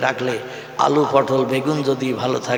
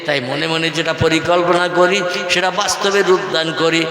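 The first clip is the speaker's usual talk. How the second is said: normal talking voice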